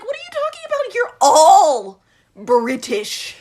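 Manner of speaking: stunned disbelief breaking into laughter; incredulous outburst. Retold or imitated. Mocking the British characters, loud incredulous laugh. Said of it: "I was like".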